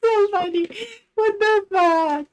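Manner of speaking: high cry-laughing; laughing hard. Real, not acted. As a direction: squeal through laughter.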